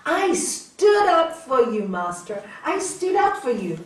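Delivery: acted self-congratulation, stretched and punched; proud boast. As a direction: announce your loyalty loudly to your master. Disciple boasting proudly to his master.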